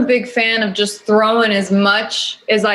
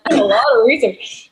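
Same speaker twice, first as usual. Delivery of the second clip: laughing; 5 semitones higher than usual. Real, not acted. Joking about watching movies as work.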